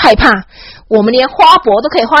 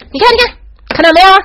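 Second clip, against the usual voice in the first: fast, much higher and louder; excited show-off; 8 semitones higher, 4 dB louder, faster than usual. Retold or imitated. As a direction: rush to show it off. Excited guide showing off. (导游兴奋地炫耀，语速快，音调高)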